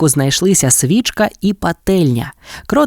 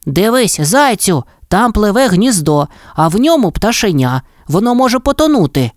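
higher alarmed pointing out; urgent mole; 7 semitones higher than usual. A narrator's reading. Mole shows hare the chick's nest, high alarm. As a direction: mole spotting danger, urging. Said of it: "крикнув кротик".

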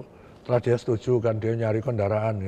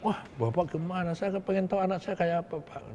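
a higher, softer worried plea; voicing the rebel leader; 7 semitones higher, 5 dB quieter than usual. Narrator voices the rebel pleading to the official, higher and softer. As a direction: play the wary rebel asking about his child.

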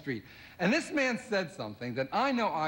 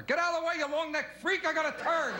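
cab driver yelling; 10 semitones higher than usual. Retold or imitated. Cab driver yelling at a giraffe in the street, mock angry high shout. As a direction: bark it at the giraffe like an angry cabbie. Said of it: "a cab driver".

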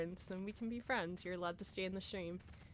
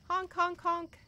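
high silly honks; silly clown; 10 semitones higher, 7 dB louder, slower than usual. Pretend. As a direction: honk away like a clown horn. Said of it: "I'll just give you personal honks".

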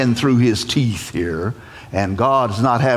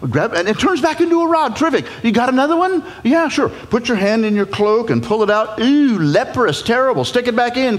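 much higher, chatty showman; breezy showman bush; 10 semitones higher than usual. Acted. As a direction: rattle off tricks like a street magician. Bush showing off tricks.

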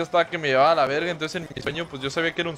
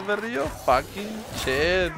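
mock drawl; 2 semitones higher, much slower than usual. Mock comment on being young.

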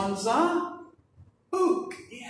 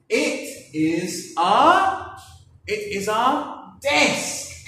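slow model sentence; 3 semitones higher, 6 dB louder, slower than usual. Shown as a demonstration. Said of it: "And we would say".

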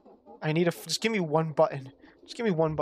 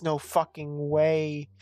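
ordinary talk, nothing marked

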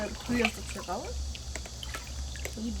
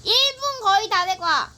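raised voice, repeating for him; calling out loud; 6 semitones higher, 4 dB louder than usual. Real, not acted. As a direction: call it out so he hears. Repeating for a child underwater.

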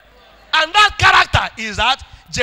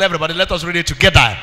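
normal talking voice

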